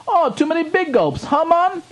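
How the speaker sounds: very high, loud mock Jamaican; hammed-up Jamaican impersonation, way up high; Jamaican accent. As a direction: squeal out a caricatured Jamaican voice. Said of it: "Seriously, the voice, though?".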